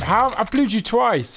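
surprised. Real, not acted. Enemy survives after two hits.

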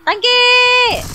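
high, loud startled shout; surprised yell. Real, not acted. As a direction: blurt it out in surprise. Startled callout in game.

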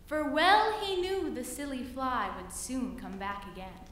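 ordinary delivery

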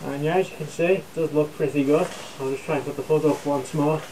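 muffled behind respirator; through a gas mask. Real, not acted.

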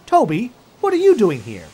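loud, high, irritated demand; snappish. Acted. Percy finds Toby delivering supplies, snaps crossly. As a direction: snap at him in cross surprise. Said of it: "he snapped".

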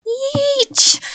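very high, loud screech; straining screech. A narrator's reading. Monster pulling at its head, straining screech. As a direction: screech with effort.

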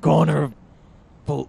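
loud gruff detective growl; noir Batman. Acted. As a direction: bark it like a brooding vigilante. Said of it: "yeah, Batman, but with, like, a hint of noir".

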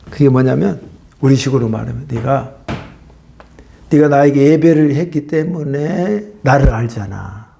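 ordinary talk, nothing marked

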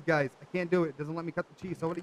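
ordinary talk

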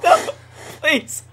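frightened plea; pleading. Real, not acted. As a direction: beg the monster to spare you. Caught by monster.

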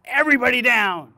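loud, high urgent order; barking a warning into the room; shouted command. Retold or imitated. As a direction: yell at everyone to drop. Busting in, warning of gunman.

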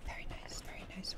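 hushed, voiceless praise; whispered approval. Real, not acted. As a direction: whisper it under your breath. Whispered approval after a kill.

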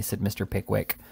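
plain reading voice

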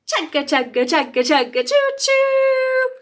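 chugging then high choo-choo; train sounds. Acted. Teacher imitating a toy train for toddlers.

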